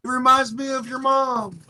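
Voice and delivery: very high, loud yell; in-character taunt. Acted. Player shouting a taunt in common, high loud yell. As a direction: holler the insult high and loud. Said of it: "I'll say it in common".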